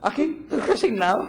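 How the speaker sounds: high, put-upon voice with a laugh; a hammed-up gloomy answer through a chuckle; mock resigned reply. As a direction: sigh it out like a long-suffering martyr.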